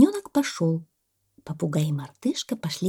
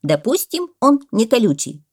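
loud, higher, insistent; parrot exclaiming; 5 semitones higher, 7 dB louder than usual. A narrator's reading. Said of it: "воскликнул попугай". (настойчивое восклицание попугая)

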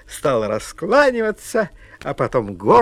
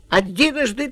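calling out; 8 semitones higher than usual. Acted. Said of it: "крикнул Ваня".

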